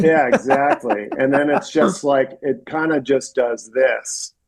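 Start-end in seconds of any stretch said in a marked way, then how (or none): 0.0-1.1 laughing it out, slowly. Real, not acted. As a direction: say it through a laugh. Laughing at the quote.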